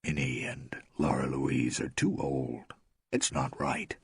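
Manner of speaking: soft, voiceless whisper; a hushed confidential whisper. A narrator's reading. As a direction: whisper it close to her ear. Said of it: "He bent over and whispered".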